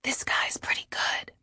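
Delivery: hushed, voiceless whisper. A narrator's reading. Fiancé to his partner in the audience, whispered. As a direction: lean in and whisper it softly. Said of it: "leans in and whispers".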